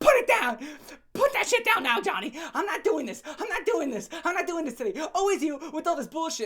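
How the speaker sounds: hammed-up furious girlfriend yelling at Johnny; imitating a shrieking scold; mock angry girlfriend. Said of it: "She's like".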